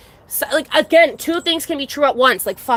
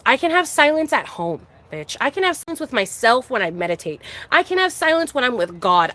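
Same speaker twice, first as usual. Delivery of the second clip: ordinary talk, nothing marked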